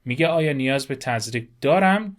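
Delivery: usual speaking voice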